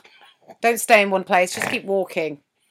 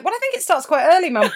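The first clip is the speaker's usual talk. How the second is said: pointed; 9 semitones higher, 11 dB louder than usual. Retold or imitated. Pointed retort to mum.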